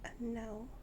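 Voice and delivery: whispered dismissive "Uh, no."; whispered bird voice. Retold or imitated. Owner voicing her bird refusing to talk, whispered. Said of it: "She's like".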